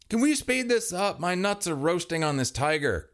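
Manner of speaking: high, half-shouted complaint; mild shout. A narrator's reading. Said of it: "Kevin shouted from the tiger side".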